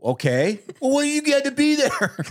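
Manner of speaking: high-pitched mock indignant back-and-forth; playfully aggrieved, high voice; mock protest. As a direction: act out a squabble, pitched well up. Acted exchange over kicking someone out.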